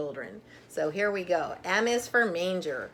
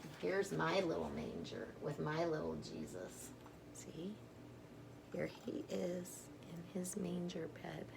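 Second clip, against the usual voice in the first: whisper; 15 dB quieter than usual. Real, not acted. Showing children the little Jesus in the manger, whispered.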